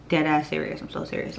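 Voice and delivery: normal talking voice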